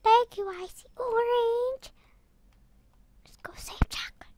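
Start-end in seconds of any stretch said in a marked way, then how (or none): 3.2-4.3 hurried whisper; sneaky rushed whisper; fast whisper. Real, not acted. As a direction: whisper the plan quickly. Sneaking to rescue friend.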